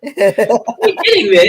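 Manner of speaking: laughing. Real, not acted. Host laughs at guest's attempt.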